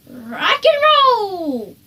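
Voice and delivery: high, loud slow rock cry; a child stomping to loud music; rock shout. Acted. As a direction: belt it like a rock fan.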